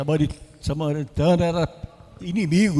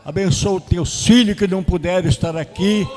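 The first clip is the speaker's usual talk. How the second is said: louder, fervent prayer voice; closing prayer; 6 dB louder than usual. Acted. Pastor praying aloud for the congregation, fervent and louder. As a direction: pray over the church with rising intensity.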